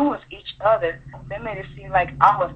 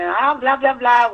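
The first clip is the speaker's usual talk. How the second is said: higher, louder blah-blah chatter; higher-pitched mimicry of idle gabbing; mock chatter; 6 semitones higher, 4 dB louder, slower than usual. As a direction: imitate a mother busy talking. Imitating mother's busy chatter.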